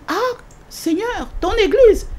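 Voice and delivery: high, astonished question. Retold or imitated. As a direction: sound taken aback, voice high. She is astonished by the answer.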